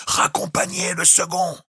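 high, strained, breathy shout; yelled order. A narrator's reading. The captain yells to the bosun over the storm.

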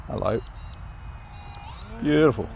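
ordinary talk, nothing marked

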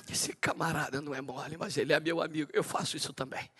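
Deus falando baixinho, com ternura; soft voice of God. Acted. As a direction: speak God's words hushed and fond. Deus voiced in sermon, hushed. (Deus falando baixo e carinhoso)

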